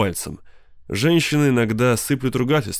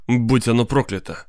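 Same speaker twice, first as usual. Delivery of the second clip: plain reading voice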